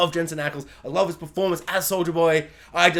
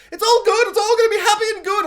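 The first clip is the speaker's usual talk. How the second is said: much higher, louder squeaky cartoon voice; high, bouncy toon voice, fast on the second line; cartoon characters; 6 dB louder than usual. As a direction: chirp it like a cheery little cartoon. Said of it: "little cartoon characters all gather around".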